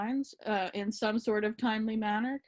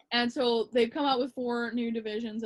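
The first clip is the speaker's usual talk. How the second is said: ordinary talk, nothing marked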